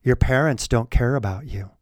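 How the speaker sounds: gentle, low, soothing sweet-talk; soft coaxing voice. Retold or imitated. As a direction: coax like a predator. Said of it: "he speaks in that kind of tone".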